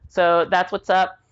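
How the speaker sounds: ordinary talk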